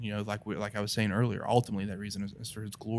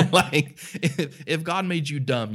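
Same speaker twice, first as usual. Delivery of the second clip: words spoken higher through laughter; laughing through the follow-up; 7 semitones higher, 5 dB louder than usual. Real, not acted. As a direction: talk while still chuckling. Still laughing after the joke.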